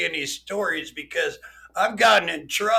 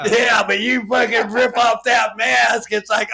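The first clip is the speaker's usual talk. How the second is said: usual speaking voice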